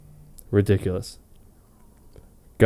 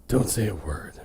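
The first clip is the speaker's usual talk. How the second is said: growled; 2 semitones lower, 7 dB quieter than usual. Acted. Bruce warning Clark in bed, a soft growl. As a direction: mutter it as a threat under the breath. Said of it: "and growled".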